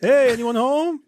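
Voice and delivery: slow, high, sing-song call; mock intruder calling hello; calling into a house. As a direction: peek in and call out casually.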